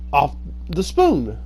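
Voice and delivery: usual speaking voice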